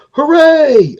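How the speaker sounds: high, loud joyful cheer; the little beings cheer the hero; crowd cheering. Acted. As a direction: cheer like a whole crowd of tiny folk.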